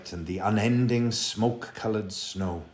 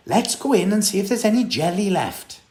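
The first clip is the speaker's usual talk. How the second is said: put-on fast, higher, louder eager boy; excited; 10 semitones higher, 7 dB louder, faster than usual. A narrator's reading. Jack urging the boys back indoors, excited. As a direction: rush it out hungry for jelly. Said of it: "Jack said".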